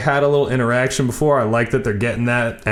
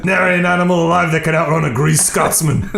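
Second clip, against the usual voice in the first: put-on shitty Scottish accent; 6 semitones higher than usual. Host doing Willie in a bad Scottish accent, laughing. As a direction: ham up a greased Scotsman. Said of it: "I apologize for my shitty Scottish accent".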